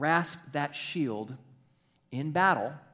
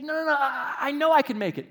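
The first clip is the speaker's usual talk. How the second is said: high, loud, stammering eagerness; jumpy guy; 13 semitones higher, 9 dB louder than usual. Acted. Pinned-down movie soldier wanting to run, jumpy. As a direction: blurt it out like a jittery soldier. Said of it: "one guy who's a little jumpy".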